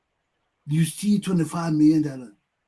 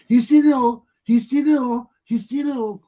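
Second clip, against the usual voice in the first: higher, louder sing-song cries of thief; mock yelling; 4 semitones higher, 6 dB louder than usual. People sitting idle crying thief, high mock yell. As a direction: wail it like a crowd that won't act. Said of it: "So we sit down there yelling".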